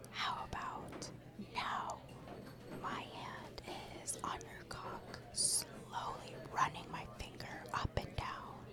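breathy seductive whisper. Acted. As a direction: whisper it in his ear.

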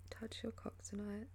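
ordinary talk, nothing marked

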